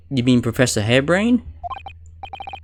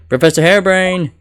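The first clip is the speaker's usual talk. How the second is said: calling out; 6 semitones higher, 5 dB louder than usual. Acted. Calling out to the professor.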